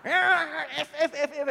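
high, loud sputtered stand-in curses; mock swearing fit. Angry surfer swearing in answer to an apology, comic sputtering. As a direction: sputter like someone too furious to speak. Imitates the rival's censored swearing.

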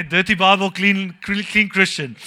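loud punchline. Acted. Joke punchline said louder.

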